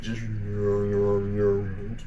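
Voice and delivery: low, slow grumpy growl; grumpy spirit voice. Pretend. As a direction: play a sulky little spirit. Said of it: "little spirit".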